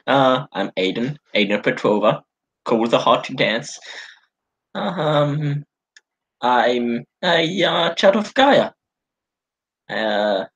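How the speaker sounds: low, halting, fumbling introduction; awkward nervous Aiden. Acted. Aiden introduces himself to Tova and Lucia, nervous and fumbling. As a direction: stumble through your own name. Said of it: "the way you're speaking right now".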